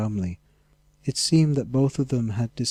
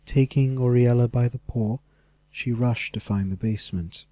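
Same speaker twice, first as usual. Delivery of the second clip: ordinary delivery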